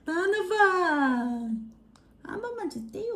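sing-song coaxing for a spoonful. Real, not acted. Feeding baby, high pitch.

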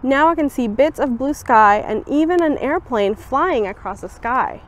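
ordinary delivery

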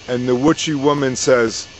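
usual speaking voice

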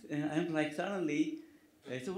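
ordinary talk

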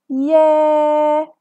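يا held loud for four counts, open and long; teacher holding the long alif vowel. Shown as a demonstration. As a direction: stretch يا for four beats. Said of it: "هنفتح صوتنا وهنطول فيه أربع عدات".

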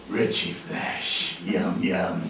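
very slow, hushed creature voice; menacing spider. Acted. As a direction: savour it softly like a hungry predator. Voices the spider smelling him.

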